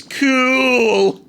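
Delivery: high, loud deadpan cool; deadpan one-word Stewie. Retold or imitated. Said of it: "And then Stewie just goes".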